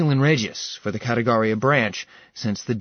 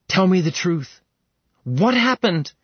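terrified plea; 7 semitones higher than usual. Acted. Seraphina, terrified, demands answers from Ilarion, high and urgent. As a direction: beg for the truth in a panic. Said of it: "Terrified and shaking".

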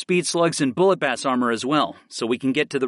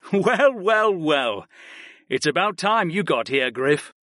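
teasing; 4 semitones higher than usual. A narrator's reading. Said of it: "Keith Almasi teased me".

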